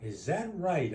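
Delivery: usual speaking voice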